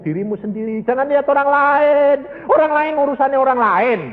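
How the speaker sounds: high, loud exhortation from the pulpit; fiery preaching. Acted. Preacher urging the congregation to read the Bible, fiery exhortation. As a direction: drive it home to the congregation.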